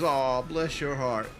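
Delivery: slow, drawn-out "Aw, bless your heart"; lightly voiced fake-sweet Southern lady; mock Southern sweet. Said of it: "When somebody goes".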